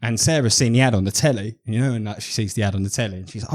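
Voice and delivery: ordinary talk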